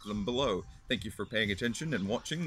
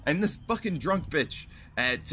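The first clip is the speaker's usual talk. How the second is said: normal talking voice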